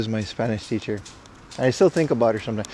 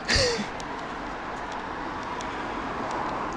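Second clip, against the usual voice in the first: high wheezy laugh; 12 dB quieter than usual. Real, not acted. Laughs after grim joke.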